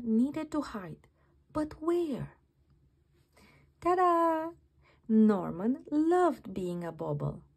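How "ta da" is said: loud, high showman reveal; proud ta-da. Acted. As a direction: present it with a flourish. Norman becomes a bauble.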